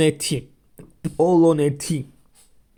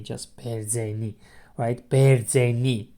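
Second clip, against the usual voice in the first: normal talking voice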